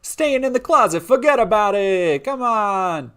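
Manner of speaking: very high and loud mock Italian mobster, slow on forget about it; mobster Italian accent. Mob boss scoffing at staying closeted, loud mock Italian accent.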